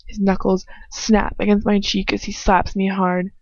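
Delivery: usual speaking voice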